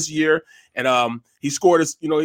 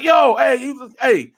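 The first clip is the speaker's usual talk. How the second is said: high, raised-voice hail; retold calling out; 9 semitones higher, 4 dB louder, much slower than usual. Biker spots the kid with his dad, calling out. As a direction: hail someone across the street.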